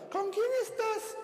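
high nagging wife demanding where he is; jealous wife. Retold or imitated. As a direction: grill him like a jealous wife. Jealous wife interrogating husband.

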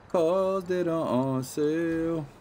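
high, slow goofy voice; silly sing-song repeat. Pretend. Gamer repeating the sale line in a high goofy voice.

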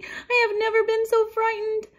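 high, loud, theatrical wail; distraught storybook mouse; sobbing. A narrator's reading. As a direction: wail tearfully, holding the pitch up. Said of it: "sobbed Annie".

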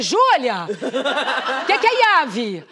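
chama a filha alto e confusa; chamando alto. Retold or imitated. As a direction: grita a pergunta pra filha no banco de trás. (chamando alto e confusa)

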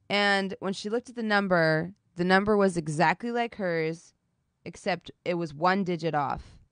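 ordinary delivery

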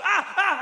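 high, loud yelps; excited child's shouts. Retold or imitated. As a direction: imitate a child's piercing little cries. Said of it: "So he starts going".

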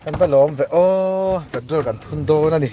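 loud exclamation. Real, not acted. Speaker exclaiming to the others in group chat, loudly. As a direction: exclaim it loudly, voice lifting in the middle.

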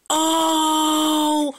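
high, loud startled "Oh!"; surprised cry. Real, not acted. Vlogger finding the gift, loud high surprised cry. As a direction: burst out in amazement.

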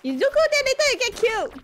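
very high, loud yelp of warning; shouted warning. Real, not acted. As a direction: cry out a danger alert to a teammate. Shouted warning during play.

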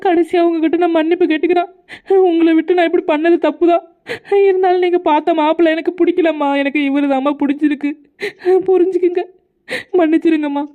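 high, pleading apology; tearful apology. Acted. Daughter begging her mother's forgiveness, tearful and high. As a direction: beg forgiveness near tears.

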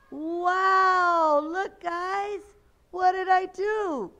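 put-on high, loud wonder for children; playing up magician's surprise; amazed reveal. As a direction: exclaim in big astonishment.

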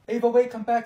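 loud call after a friend walking off. Acted. Girl calling her upset friend back.

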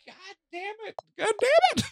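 very high squeaky curse; frustrated squeal. Real, not acted. After dying in the game, she squeals in frustration.

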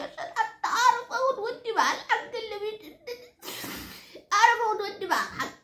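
high whiny lament with laughter in it; hammed-up self-pitying whine; mock tearful plea. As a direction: plead as if about to cry, comically. Comic pleading lament about husband.

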